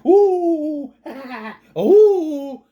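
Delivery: high, loud imitated laugh with whoops; exaggerated cackle breaking into high oohs; mock giggling and oohing. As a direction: mimic her manic laughter. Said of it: "she's just going".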